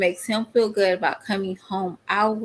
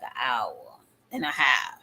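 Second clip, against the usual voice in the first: whisper; 7 semitones lower, 4 dB quieter than usual. Real, not acted. Wry whispered punchline.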